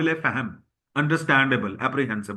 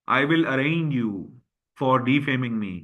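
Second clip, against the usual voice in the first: slow example sentence; 2 semitones lower, much slower than usual. Shown as a demonstration. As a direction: read it out slowly and clearly, word by word. Teacher gives example sentence slowly. (धीरे धीरे उदाहरण वाक्य)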